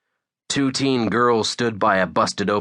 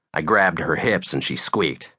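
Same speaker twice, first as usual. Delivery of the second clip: ordinary delivery, nothing marked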